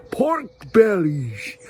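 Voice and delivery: "pork bellies" drawn out slowly in a mock American accent; slow, exaggerated American "pork bellies". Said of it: "I don't know if that's the right accent".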